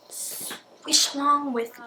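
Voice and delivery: drawn-out, sing-song invite; playful slow swish. Acted. Inviting viewers along, slow and playful.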